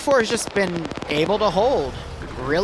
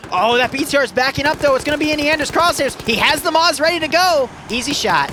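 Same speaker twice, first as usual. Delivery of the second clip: higher, louder, building excitement; excited play-by-play; 6 semitones higher, 3 dB louder than usual. Real, not acted. Caster to viewers as a launcher lines up, building excitement. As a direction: build the tension toward the shot.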